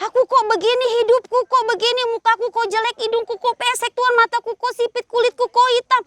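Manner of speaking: high, rapid mock tirade at God; angry protest. Teacher acts out a child blaming God for their looks, angry protest. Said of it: "dia nggak terima Tuhan".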